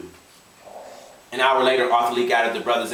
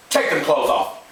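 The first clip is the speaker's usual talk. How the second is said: firm, slightly raised command; stern order; 4 semitones higher, 3 dB louder than usual. Acted. Father ordering child to undress, sternly. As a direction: order it, holding the anger in.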